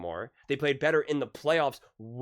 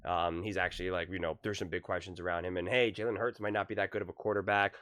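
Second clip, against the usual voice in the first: lower, softer mock paraphrase of the analyst; half-hearted impression of a pundit, lower and quieter; 7 semitones lower, 4 dB quieter than usual. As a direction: lightly parody his old take, dismissive. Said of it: "how one minute you can say".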